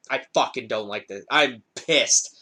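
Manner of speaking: low-pitched imitation of being angry; retold upset intensity. Streamer ranting to viewers, retold low upset intensity. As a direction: replay your anger, voice dropped low. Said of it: "the intensity in my voice of how upset".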